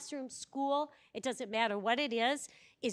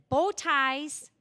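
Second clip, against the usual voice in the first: loud, higher mock yell; retold scream; 4 semitones higher, 8 dB louder than usual. Pro-life counselor calling to a woman at the clinic, mock scream. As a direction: call it out abruptly, half screaming. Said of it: "He just screamed it out".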